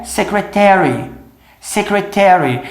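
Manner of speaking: secretary with the A, secre-TARE-y; American secretary, every syllable. Shown as a demonstration. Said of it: "só escutava com A".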